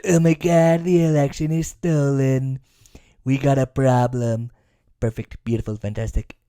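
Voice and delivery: lightly voiced Trump, dropping low and soft; a mild take on Trump's bluster; Trump impression. Mocking Trump's election claims in his voice. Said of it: "I always have to do that 'cause that's all he says".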